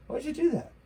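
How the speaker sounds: high pitched incredulous jab at Joe; incredulous squeak. Real, not acted. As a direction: squeak the question in disbelief. Ranting at Biden.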